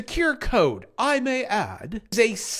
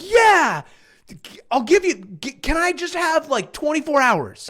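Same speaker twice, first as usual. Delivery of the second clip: imitating excitement; 7 semitones higher, 4 dB louder than usual. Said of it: "You know how he gets all excited".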